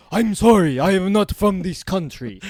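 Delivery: loud, high mock apology; mock foreigner. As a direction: play the confused foreigner. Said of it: "And Ari's like".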